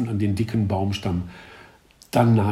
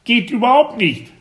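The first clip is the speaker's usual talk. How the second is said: much higher, louder duck voice; quacking duck voice; 14 semitones higher, 7 dB louder than usual. A narrator's reading. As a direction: squawk it like an upset duck. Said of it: "schnatterte sie".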